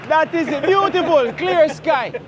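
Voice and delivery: high, loud delighted exclaim; real delight at the weather; excited praise. Real, not acted. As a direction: marvel at the clear sky. Admiring the sunny weather.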